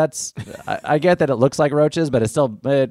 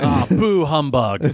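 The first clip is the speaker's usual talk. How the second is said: grumpy Scrooge voice; 4 semitones higher, much slower than usual. Acted. Scrooge-like grumbling.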